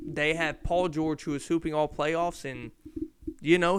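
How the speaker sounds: ordinary talk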